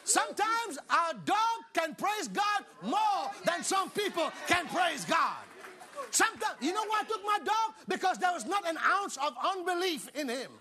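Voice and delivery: emphatic, punched-out pulpit shout; fired-up preacher; full-force preaching. Real, not acted. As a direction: drive each phrase home to the congregation.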